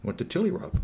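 ordinary delivery, nothing marked

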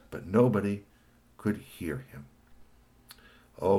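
usual speaking voice

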